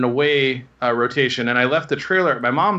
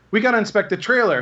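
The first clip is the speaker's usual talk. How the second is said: put-on higher, fast and insistent young rookie; eager young cop; 7 semitones higher, faster than usual. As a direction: push it like an eager rookie sticking to the rules. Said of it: "The younger cop looks at him and goes".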